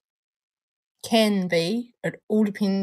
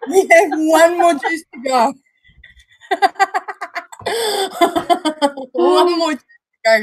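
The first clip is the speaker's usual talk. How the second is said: louder and higher, an eager announcer; excited announcing; 9 semitones higher, 7 dB louder than usual. Real, not acted. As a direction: pump up the crowd for the last juice. Excited announcing the last juice.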